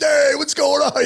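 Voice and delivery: high, slow shouted hello; friendly greeting. Retold or imitated. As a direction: call out hi to the whole team. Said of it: "I was kinda coming in like".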